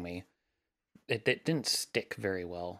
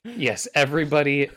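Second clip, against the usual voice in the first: ordinary delivery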